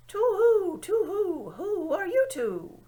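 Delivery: high, hooting owl voice, slow on the last words. A narrator's reading. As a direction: hoot it like a big owl peering. Owl speaks first time.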